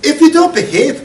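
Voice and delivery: put-on high, sharp warning to a child; grandmother's threat. Grandmother threatening her mischievous grandson, high sharp warning. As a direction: warn the boy with the voice pitched high. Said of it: "She said".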